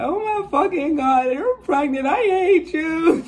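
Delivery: high, loud wailing sob of a friend; mock crying friend. Friend crying over the news, high tearful wail.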